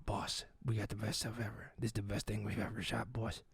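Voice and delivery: low, very soft producer voice; hushed, low report down the phone; overheard phone call. Retold or imitated. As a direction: murmur thrilled news secretly to the boss. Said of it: "I hear the phone call".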